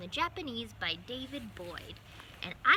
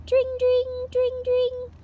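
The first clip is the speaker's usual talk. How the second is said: much higher, louder sing-song ding of a bell; bicycle bell; 15 dB louder, much slower than usual. Acted. Mice ringing bicycle bells on the way, sung bell sound. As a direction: ring it out like a little bike bell.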